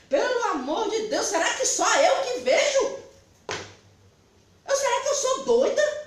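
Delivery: laughing in disbelief; laughing while asking if she's crazy; giggling incredulous. Real, not acted.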